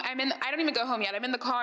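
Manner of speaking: ordinary talk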